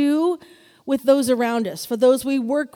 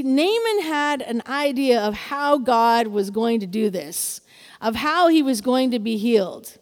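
ordinary talk, nothing marked

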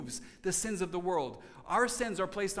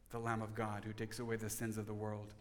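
much softer, low reverent repeat of the quote; hushed; 7 semitones lower, 8 dB quieter than usual. Retold or imitated. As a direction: say it again almost under the breath, in awe. And that's when John said.

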